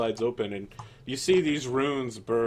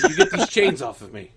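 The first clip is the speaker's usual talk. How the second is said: laughing; 3 semitones higher, 8 dB louder than usual. Acted. Prisoner laughs while speaking.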